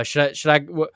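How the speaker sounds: ordinary delivery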